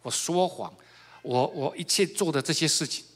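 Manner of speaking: normal talking voice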